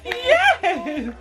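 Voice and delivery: high, laughing gushing thanks. Real, not acted. Baby gives her something.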